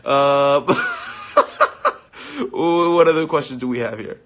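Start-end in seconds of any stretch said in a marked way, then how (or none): 0.7-2.5 high-pitched giggle; real high-pitched laugh. Real, not acted. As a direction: burst into a squeaky laugh. Laughs at fan comment.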